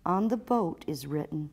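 usual speaking voice

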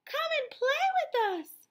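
high, loud playful call; calling fish. Acted. Other fish calling Rainbow Fish to come and play. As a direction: call out across the water. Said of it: "they would call".

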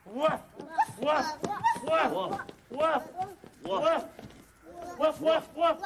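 playful woof, woof like a dog; dog barks. Pretend. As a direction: bark along like a puppy. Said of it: "let's all try it".

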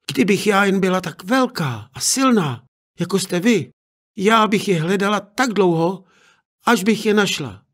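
put-on a brave girl insisting; determined girl. Girl vows to search.

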